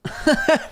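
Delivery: high breathy laugh. Real, not acted. Reaction to documentary.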